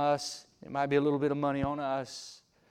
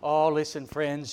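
normal talking voice

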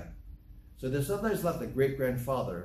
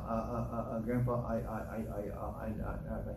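soft, lower imitation of a stammering little kid; stuttering grandchild; 4 semitones lower, 7 dB quieter, faster than usual. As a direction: get stuck repeating the first word, quietly. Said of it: "He talks like this now".

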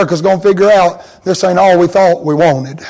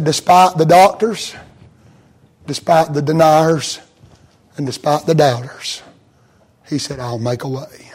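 softer and lower, slowing down; quiet, slow voice of God; 4 semitones lower, 5 dB quieter than usual. Retold or imitated. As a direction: promise it gently.

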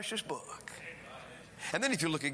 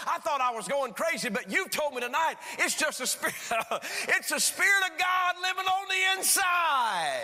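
exultant climax; 11 semitones higher, 4 dB louder than usual. Retold or imitated. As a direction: build through a laugh into a triumphant cry. Preacher builds to climax of testimony.

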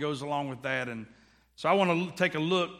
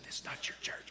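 whisper; 11 dB quieter, faster than usual. Retold or imitated. Wife whispering in church.

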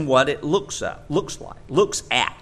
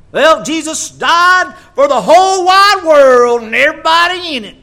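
mocking Southern accent; 12 semitones higher, 11 dB louder than usual. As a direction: sing it out like a down-home preacher, way up high. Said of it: "I've only heard that in that accent".

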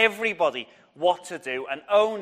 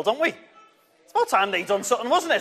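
ordinary delivery

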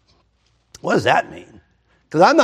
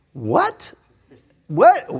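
mock disbelief, the second what jumps much higher; baffled what; 10 semitones higher than usual. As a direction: play a scholar who is stunned. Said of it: "where I'm going".

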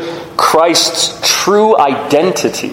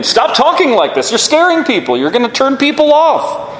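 much higher, urgent scolding voice; Peter rebuking Jesus; 11 semitones higher than usual. Retold or imitated. Peter scolds Jesus for frightening the followers, in a high urgent voice. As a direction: play Peter scolding his teacher, pitched up. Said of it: "But Peter's rebuking him".

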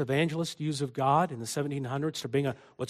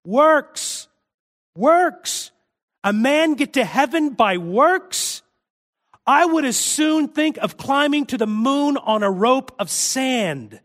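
thundering cry, much higher and louder; 10 semitones higher, 8 dB louder, slower than usual. Retold or imitated. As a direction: boom it out like Whitefield on the barrel. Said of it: "cried out in a tone of thunder".

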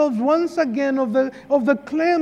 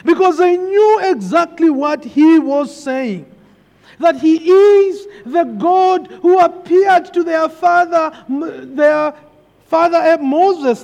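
loud preaching; 3 semitones higher, 4 dB louder than usual. Acted. Preaching build.

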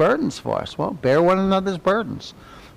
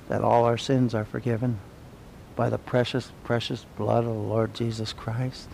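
low, soft awe; hushed reverence; 8 semitones lower, 10 dB quieter than usual. Real, not acted. Preacher to congregation on forgiven sins, hushed reverence. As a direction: drop right down, tender and reverent.

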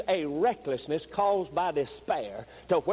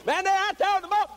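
high, loud, driving sermon shout; impassioned revival preacher crying out; full-force preaching; 6 dB louder than usual. Real, not acted. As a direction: preach at the top of your voice, building to a cry.

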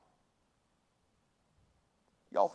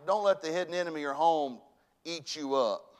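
normal talking voice

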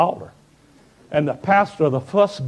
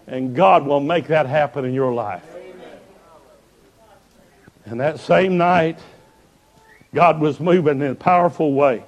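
ordinary delivery

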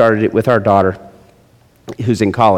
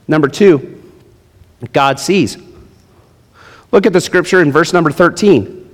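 ordinary talk

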